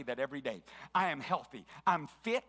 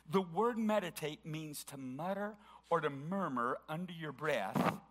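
ordinary talk, nothing marked

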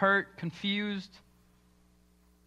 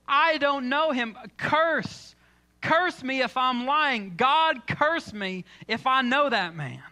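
higher, louder and slow, swearing an oath; indignant cursing denial; 6 semitones higher, 5 dB louder, slower than usual. Retold or imitated. Peter cursing himself before the crowd, loud and slow. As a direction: hammer each word, furious and indignant. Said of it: "Peter gets indignant at this point".

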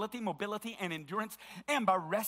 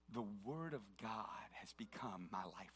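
1 whisper; 9 semitones lower, 13 dB quieter than usual. Real, not acted. Testimony of surviving depression.

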